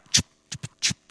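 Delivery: unvoiced clicking tschüp tschüp tschüp; quick tschüp noise. Preacher imitates easy quick walking with a breathy tschüp sound. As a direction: mimic someone striding easily.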